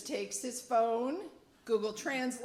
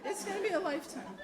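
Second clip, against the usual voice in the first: laughing repeat; 9 semitones higher, much faster than usual. Real, not acted. Repeats more softly.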